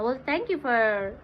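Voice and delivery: usual speaking voice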